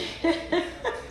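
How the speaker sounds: soft, high chuckle; quiet high giggle; real laughter. Real, not acted. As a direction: let out a light breathy laugh. Laughs after her warning.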